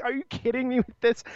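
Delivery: high, incredulous delight; can't believe how silly it is; hammed-up incredulous question; mock disbelief.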